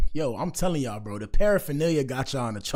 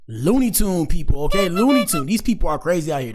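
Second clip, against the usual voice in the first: mock rant; 5 semitones higher, 7 dB louder than usual. Host ranting loudly.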